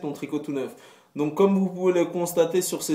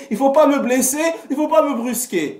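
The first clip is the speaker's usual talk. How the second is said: mock wounded whine; 11 semitones higher, 7 dB louder than usual.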